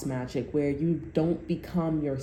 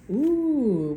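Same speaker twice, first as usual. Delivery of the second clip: a much higher, louder ooh; delighted little gasp of surprise; pleased surprise at the card; surprised ooh; 10 semitones higher, 5 dB louder than usual. Real, not acted. Reacting to drawing owl card.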